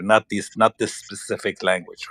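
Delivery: ordinary delivery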